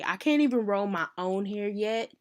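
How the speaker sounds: ordinary talk, nothing marked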